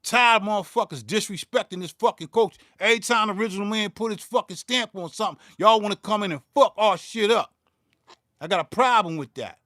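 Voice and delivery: real anger. Real, not acted. Ranting at viewers who disrespect the culture, loud and angry. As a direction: let the frustration push the volume up. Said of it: "'cause I'm tired".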